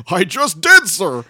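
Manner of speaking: high, loud start dropping away; mock announcer's gleeful comeback; Morrow. Announcer replying to his colleague, mock laughing reply. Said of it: "Morrow laughingly replies".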